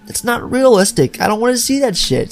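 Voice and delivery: high-pitched exasperation; exasperated complaint. Real, not acted. Host hates the blinking-head gag, exasperated complaint.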